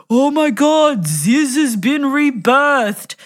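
high, drawn-out mock yell; imitated street yell. Stranger heckles the shirtless date from across the street, imitated yell. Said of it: "someone yelled out across the street".

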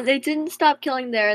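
usual speaking voice

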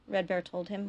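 ordinary delivery, nothing marked

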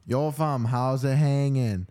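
put-on Toronto guy drawl, slow finish; Toronto slang accent. Greeting strangers in games, mock Toronto accent. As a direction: greet them like a Toronto roadman. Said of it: "speak like a Toronto guy".

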